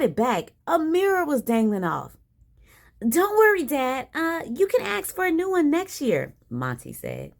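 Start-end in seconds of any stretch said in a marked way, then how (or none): 3.0-6.3 put-on high-pitched kid soothing Dad; Monty's reassurance. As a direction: pitch it up like a boy placating his father. Monty reassures Dad after the breakage.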